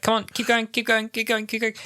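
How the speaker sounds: high-pitched rushed usher chivvying a queue; hurrying usher. Retold or imitated. Staff herding fans through a photo queue, hurried and high. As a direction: imitate an usher hustling people along. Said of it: "they were going triple speed".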